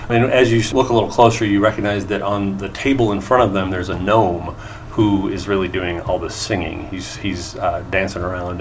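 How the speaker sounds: ordinary delivery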